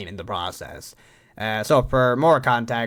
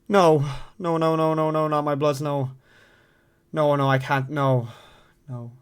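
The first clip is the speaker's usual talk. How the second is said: pleading dread; 3 semitones higher than usual. Acted. Dreads having bloods done.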